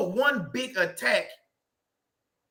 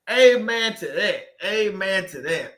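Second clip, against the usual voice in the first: drawn-out amen; 3 semitones lower than usual. Real, not acted. Repeated agreement with a viewer.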